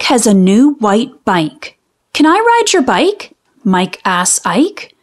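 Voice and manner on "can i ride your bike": put-on high, quick little-boy asking; eager little boy. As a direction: beg for a turn like an excited kid. Mike asks to ride Ike's bike.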